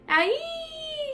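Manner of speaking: very high panicked shriek; high terrified shriek; bird shriek. A narrator's reading. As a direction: shriek while falling. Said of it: "shrieked Flap".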